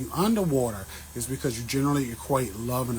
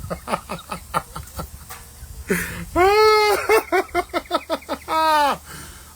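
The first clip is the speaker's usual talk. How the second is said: high wheezing laugh; 7 dB louder than usual. Real, not acted. Cracking up at own joke.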